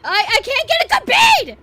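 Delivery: high, very loud drawn-out wail; frantic yelling. Real, not acted. Player unable to aim at the attacker, frantic yelling. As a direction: wail in frustrated panic.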